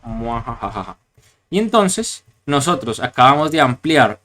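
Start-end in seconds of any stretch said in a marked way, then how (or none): 0.0-1.0 soft, low villain laugh; evil cackle. Pretend. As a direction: cackle like a wicked sorcerer. Wizard's evil laugh after line.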